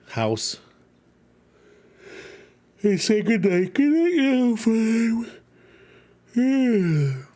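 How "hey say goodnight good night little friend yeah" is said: high, drawn-out cutesy goodnight; cutesy little-friend voice. Pretend. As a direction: pitch it way up, as to a tiny friend. Playing bedtime with little friend.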